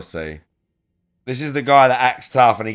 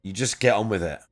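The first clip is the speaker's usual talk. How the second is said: normal talking voice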